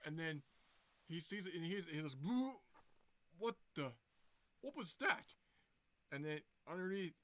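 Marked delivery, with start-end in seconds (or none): 2.2-2.5 high, loud playful scare; loud boo. Acted. Character startles villain. 3.4-5.3 high, alarmed question; startled. Acted. Startled villain reacts.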